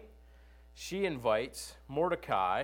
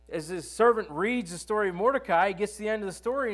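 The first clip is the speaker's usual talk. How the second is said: usual speaking voice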